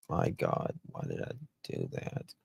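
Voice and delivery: hushed whisper to herself. Real, not acted. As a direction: breathe it out under her breath. Muttering to herself after mistake.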